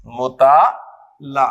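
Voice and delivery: मुताला drawn out slowly and low, each syllable clear; slow drilled word. Shown as a demonstration. Teacher to class, modelling मुताला slowly. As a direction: say मुताला slowly and deliberately, falling. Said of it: "teacher drills the word slowly".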